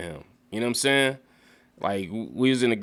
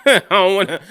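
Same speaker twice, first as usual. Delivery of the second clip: laughing; 8 semitones higher, 9 dB louder than usual. Real, not acted. Laughing mid-sentence about pronunciation.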